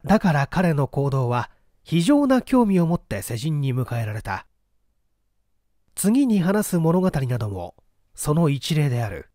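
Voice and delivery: ordinary delivery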